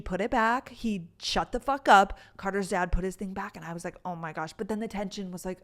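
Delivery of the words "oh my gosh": soft, low aside; understated, retold dismay; muted retold reaction. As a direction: say it under your voice, lower and quieter.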